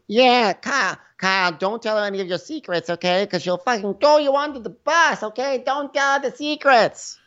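high, put-on catty voice; mocking Ramona. Ramona warning Kyle about secrets in a high catty voice. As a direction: play her as a catty gossip. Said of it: "impression of Ramona warning Kyle".

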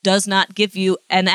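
ordinary delivery

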